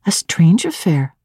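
ordinary delivery

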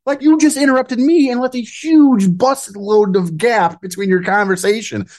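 high, exasperated outburst; heated rant. Real, not acted. Host venting about the mayor, exasperated. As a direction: let the irritation spill over.

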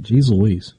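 usual speaking voice

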